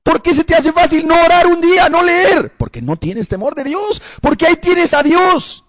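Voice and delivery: high, loud preaching shout. Real, not acted. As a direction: thunder it at the congregation. (predicación a gritos, voz alta y fuerte)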